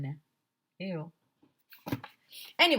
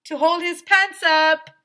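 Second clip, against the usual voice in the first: much higher, louder, slow lady voice; firefighter punchline; 11 semitones higher, 13 dB louder, slower than usual. Acted. Firefighter giving the joke punchline to the children. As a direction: deliver the punchline proudly. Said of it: "said Missus Meyer".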